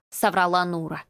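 ordinary delivery

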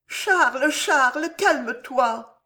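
high, pleading woman's voice, slow on the last words; soothing mistress. Acted. Mistress begging the frantic king to calm down, high and pleading. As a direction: coax him gently back to calm.

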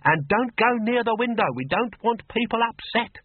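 a fussing mother, pitched far up; nagging old mother. Acted. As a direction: fret at her daughter from the stairs.

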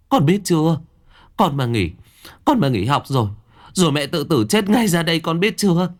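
mother frantically forbidding her son to quit; alarmed mother. A narrator's reading. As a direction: refuse firmly and climb in pitch. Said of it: "giật mình, lắc đầu".

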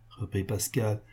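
ordinary delivery

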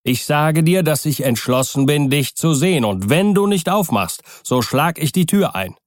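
high, forceful ultimatum; urgent, insistent demand; calling loudly. A narrator's reading. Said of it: "rief er mit lauter Stimme".

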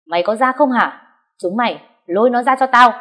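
high, loud shouted order; shouting. Acted. Wife shouting at her men to drag the mistress out. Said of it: "Chị dâu quát lên".

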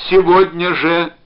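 slow, loud, high cry; drawn-out urgent yell; insistent shout. A narrator's reading. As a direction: shout it insistently, stretching the words. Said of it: "закричал он".

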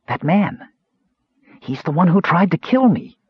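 small and frightened, up high. Acted. Kender telling the mage of the killer, small and high. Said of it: "said Earwig in a small voice".

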